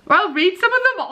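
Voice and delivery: a silly lilt she didn't mean to do; odd sing-song. Real, not acted. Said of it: "Why did I say that like that?".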